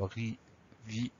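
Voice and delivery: "rivi" split into two separate syllables, ri, vi. Shown as a demonstration. Teacher sounding out the first two syllables, "rivi" broken syllable by syllable. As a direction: sound out "rivi" slowly, one syllable at a time. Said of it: "pour les deux premières syllabes".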